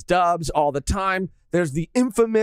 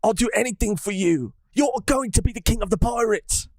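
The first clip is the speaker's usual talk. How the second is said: British Sanji; 2 semitones higher than usual. Pretend. Sanji pledging himself to captain Luffy, mock British accent. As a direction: declare loyalty in a hammed-up British voice. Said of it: "I feel like my British is not too bad".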